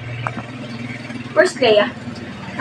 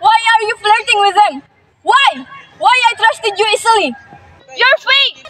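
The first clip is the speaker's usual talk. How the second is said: loud, betrayed outburst; hurt and furious, voice raised; angry accusation; 3 semitones higher, 6 dB louder than usual. Acted. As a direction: confront a false friend at full voice. Acted quarrel, accusing best friend of betrayal.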